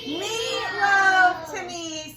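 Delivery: slow, high, loud reveal; little boy's triumph. Acted. Little boy solving the riddle, high and slow. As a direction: sing it out like a proud child.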